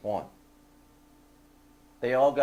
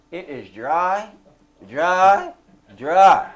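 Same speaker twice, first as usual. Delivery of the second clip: each "dry" stretched high and louder, building to a shout; emphatic repeated DRY; 6 semitones higher, 14 dB louder, much slower than usual. Shown as a demonstration. Speaker complaining about birthday cake to the audience, "dry" mounting loudly. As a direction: hammer "dry" harder each time, drawing it out.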